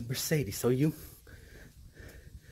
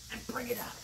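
whispering; 10 semitones higher, 8 dB quieter than usual. Real, not acted. Exercise count, whispered line.

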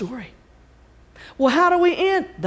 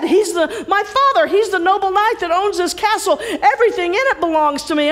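raised, higher insistent pleading; eager Jack; 9 semitones higher, 4 dB louder than usual. Acted. Jack quickly reassuring the harp he owns it. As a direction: talk fast to win the harp over. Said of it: "Jack turns to the harp and he says".

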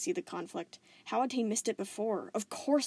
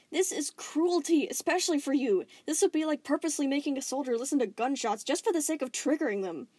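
outraged; 6 semitones higher, 6 dB louder than usual. Acted. Steven condemning the Diamonds' cruelty, outraged. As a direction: condemn it with force.

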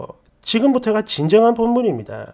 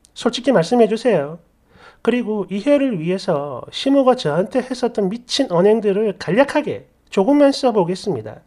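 usual speaking voice

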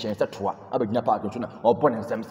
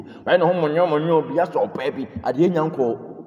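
higher, louder, slow mocking jeer; mocking scorn; 5 semitones higher, 5 dB louder, slower than usual. Real, not acted. Fan mocking a showboating player, slow and jeering. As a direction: drag it out in mockery.

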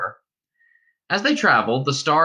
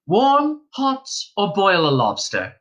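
higher, louder caring question; solicitous innkeeper's wife; 8 semitones higher, 3 dB louder, slower than usual. Acted. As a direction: fuss over the guest's bath. Wife offers bath choices.

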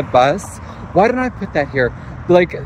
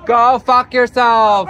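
louder, slow, fed-up telling-off; real angry retort; 5 semitones higher, 5 dB louder, slower than usual. Real, not acted. Real quarrel with old man.

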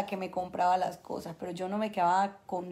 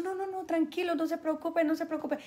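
soothing little brother; 7 semitones higher, 4 dB quieter than usual. Retold or imitated. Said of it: "Y yo".

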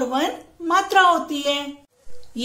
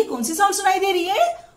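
normal talking voice

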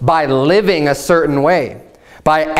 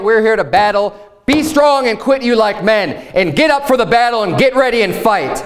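pitched well higher and slowed, a stirring call to arms; battle rally cry; 6 semitones higher than usual. Acted. Preacher to the men, voicing soldiers rallying for war, pitched up and urgent. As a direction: rouse the troops: stop being scared and fight.

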